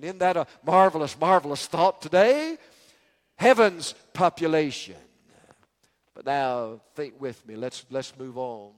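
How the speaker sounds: usual speaking voice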